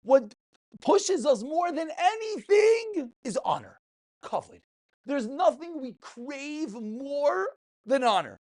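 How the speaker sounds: ordinary delivery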